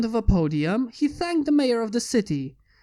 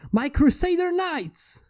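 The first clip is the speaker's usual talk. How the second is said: rising, proud showman reveal; grand announcement; 7 semitones higher, slower than usual. Acted. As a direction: unveil them like a ringmaster.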